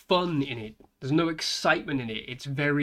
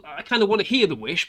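ordinary talk, nothing marked

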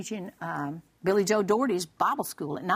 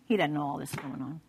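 ordinary delivery

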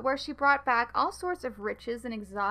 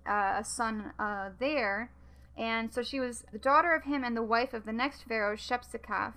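ordinary talk, nothing marked

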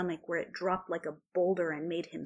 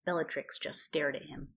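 ordinary delivery

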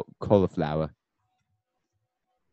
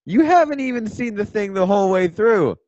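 mock indignant outburst; 11 semitones higher, 8 dB louder than usual. Real, not acted. Defending himself in a mock argument.

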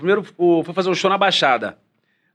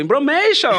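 fake foreign language; 7 semitones higher than usual. Shown as a demonstration. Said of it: "você desenrola no inglês".

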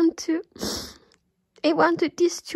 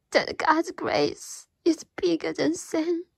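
ordinary delivery

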